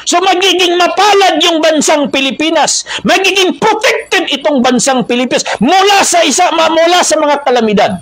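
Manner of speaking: high-pitched, emphatic preaching; impassioned preaching. Real, not acted. Preacher promises the Philippines protection from calamity, impassioned preaching.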